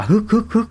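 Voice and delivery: breathless mock gasps, too furious to speak; imitated choking gasps. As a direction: mimic the mother-in-law choking on her rage. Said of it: "bà tức xanh môi luôn".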